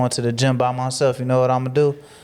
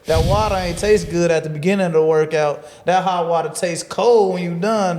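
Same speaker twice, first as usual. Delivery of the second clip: usual speaking voice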